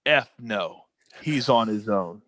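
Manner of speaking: low, flat, curt brush-off; Vince McMahon's dismissal. Retold or imitated. The boss answering the cops, a low curt refusal. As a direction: dismiss him without a glance, low. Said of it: "he goes".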